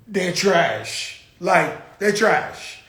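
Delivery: normal talking voice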